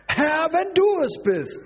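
high, drawn-out mock call; mocking imitation. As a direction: imitate him incredulously, stretched and high. Mocks Petrus' doubting reply. (spöttisch nachgeäfft, hoch und gedehnt)

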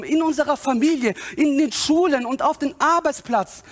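high, impassioned sermon delivery; full-force preaching. Real, not acted. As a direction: drive it home to the congregation.

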